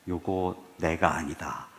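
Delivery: ordinary delivery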